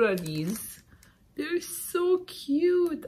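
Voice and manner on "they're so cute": much higher, slow cooing voice; cutesy coo. Real, not acted. Cooing over cute stamps.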